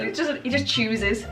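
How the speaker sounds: ordinary delivery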